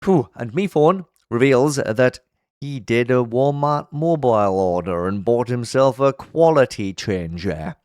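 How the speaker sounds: ordinary talk, nothing marked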